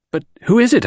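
high, sharp exclaimed question; urgent cry. A narrator's reading. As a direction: demand it, alarmed and shocked. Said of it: "I cried".

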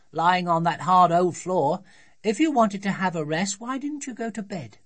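put-on high-pitched child telling Dad off; scolding little girl. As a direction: reason bossily like a small daughter. Voices Sophie scolding father.

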